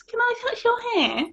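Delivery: high put-on sugary voice; mock sweet colleague. The lady asking before grabbing, high sugary mimic. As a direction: ask it in a falsely polite voice.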